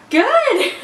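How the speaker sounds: high, loud forced brightness; fake cheerful. Girl hiding her rejection from a stranger, fake cheerful. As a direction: sell a cheerful lie with a smile. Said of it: "put on the smile".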